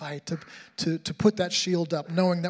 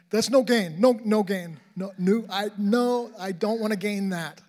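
loud, high, frantic denial of death; pleading no to dying, rising and loud; panicked protest; 4 semitones higher, 6 dB louder than usual. Retold or imitated. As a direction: cry out in alarm at the diagnosis. Panicked patient's protest voiced.

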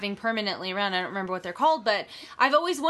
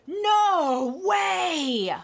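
excited; 8 semitones higher than usual. Real, not acted. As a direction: burst out in delight. Sees the pumpkin gift.

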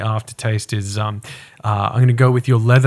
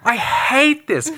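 exasperated rant; 13 semitones higher than usual. Real, not acted. Host ranting about the whiskey.

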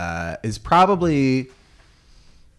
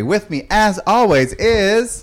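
drawn-out announcement; 10 semitones higher, 6 dB louder, slower than usual. Acted. Host announces co-host dramatically.

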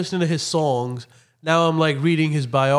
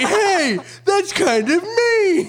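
giggling high and slow; laughing, high; 14 semitones higher, much slower than usual. Retold or imitated. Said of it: "And then I was like".

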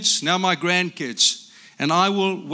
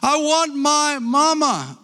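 put-on very high, slow whiny small child; imitating small grandson; 10 semitones higher, 3 dB louder, much slower than usual. Grandpa mimicking his little grandson wanting home, very high whiny. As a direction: wail it like a tired little grandson. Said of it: "said my little grandson".

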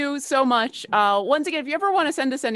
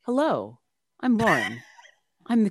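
in-character voice. Acted. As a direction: slip into her character's voice. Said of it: "I have to be in character here".

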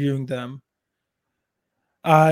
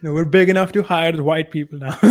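laughing; 5 dB louder than usual. Real, not acted. Joking after laughing about hiring.

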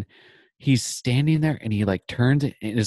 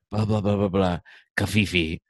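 Smeagol voice; 2 semitones lower than usual. Retold or imitated. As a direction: drag it out like a gollum creature. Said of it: "Can you read these in Smeagol's voice".

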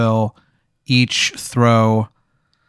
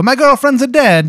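higher hammed-up Italian accent, broken grammar; mock Italian accent; 10 semitones higher than usual. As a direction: announce the loss like a cartoon Italian man. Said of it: "talking like an Italian man".

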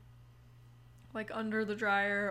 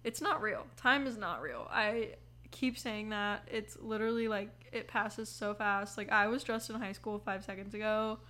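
ordinary delivery

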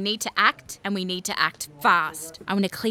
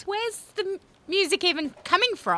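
much higher, exasperated squeak; baffled outburst; 12 semitones higher than usual. Acted. Prime Minister baffled by invisible music, high exasperated. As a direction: squeak it out in disbelief.

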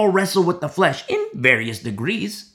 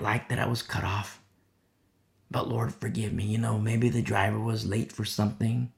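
hushed, low confession to God; humble prayer; 5 semitones lower, 7 dB quieter than usual. Acted. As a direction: pray softly with head bowed. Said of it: "you bow your head".

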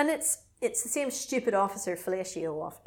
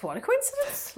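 sarcastic. Retold or imitated. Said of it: "I was like".

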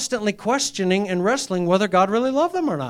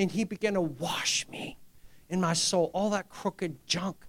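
sudden whisper; 2 semitones lower, 9 dB quieter than usual. Real, not acted. Describing God cleansing him.

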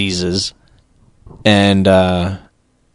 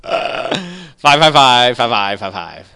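fading echo countdown; 4 dB quieter, much slower than usual. Acted. Host counting down, voice fading away.

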